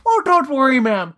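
put-on movie cop with a Minnesotan accent. Cop reassuring the mother, mock Minnesotan accent. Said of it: "who has the Minnesotan accent".

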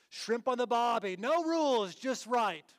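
"no rules" in a hammed-up Australian accent; playful Aussie slogan voice. Shown as a demonstration. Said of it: "like, with a Australian accent".

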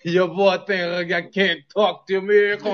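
loud, slow Jamaican patois put-on; hammed-up Jamaican accent, half laughing. Host shows off accents on request, hammed-up Jamaican accent. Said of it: "Give me a Jamaican accent".

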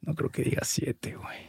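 whispered aside to a teammate. Real, not acted. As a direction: murmur the doubt secretly. Whispered doubt during a game.

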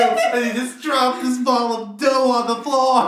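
loud, slow and high, laughing; rising to a laughing peak; excited. Real, not acted. Telling the dropped dough.

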